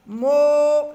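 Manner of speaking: "Mo" loud and drawn out; isolated syllable, held. Shown as a demonstration. Teacher isolating the second syllable, "Mo" held loudly. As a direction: hold the vowel of "Mo" long and loud. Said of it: "la deuxième syllabe".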